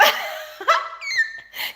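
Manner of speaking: squealing high-pitched laugh. Real, not acted. Laughing at herself for groaning when she gets up.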